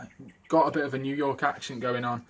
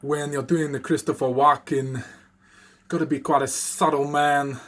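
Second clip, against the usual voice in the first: Walken's choppy Brooklyn cadence, louder; Christopher Walken impression; 5 dB louder than usual. Quoting Funny Guy Timmy's Walken line, choppy Brooklyn accent. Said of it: "what he kinda says is in the video is".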